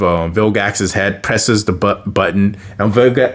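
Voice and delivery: normal talking voice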